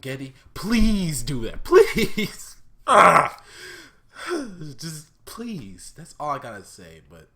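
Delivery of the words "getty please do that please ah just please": loud pleading. Real, not acted. Begging the creator for ending.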